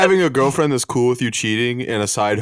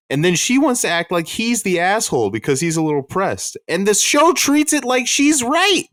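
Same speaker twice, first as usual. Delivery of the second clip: higher, indignant rant; 13 semitones higher than usual. Real, not acted. Complaining to friends about a TV character, indignant and higher. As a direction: get worked up about her.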